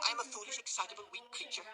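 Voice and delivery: high, fluttering lady's voice; self-pitying matron. Acted. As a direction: play helpless and delicate.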